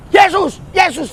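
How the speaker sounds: high pleading Yesus Yesus; mock cry to Jesus; calling on Yesus. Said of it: "Sama kau bilang".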